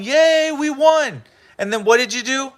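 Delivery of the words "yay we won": mock cheer. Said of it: "the natives are going".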